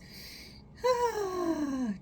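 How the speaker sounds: high, heady sigh; a thin sigh kept up in the head; wrong head sigh. Shown as a demonstration. Therapist showing the wrong way to sigh, high head sigh. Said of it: "don't do a head sigh".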